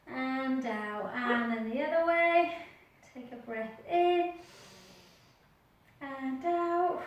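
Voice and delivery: calm, soft breath guidance; gentle instructor voice; yoga breathing cues. Acted. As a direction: talk them through each breath.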